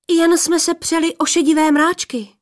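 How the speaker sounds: high, eager excuse; anxious cover-up. A narrator's reading. Daughter making excuses to her suspicious father, high and eager.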